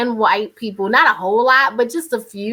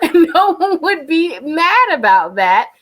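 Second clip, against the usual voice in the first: talking through laughter, higher and louder; laughing; 8 semitones higher, 5 dB louder than usual. Real, not acted. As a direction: let the laugh lift the whole line. Laughing at own British-accent joke.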